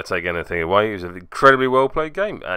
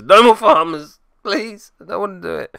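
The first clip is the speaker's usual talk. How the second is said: mock pleading; 10 semitones higher, 6 dB louder than usual. Pleading not to repeat.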